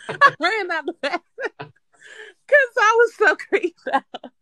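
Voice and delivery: high, giggling through the words; laughing. Real, not acted. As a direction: laugh while you tell it. Laughing at own ghost story.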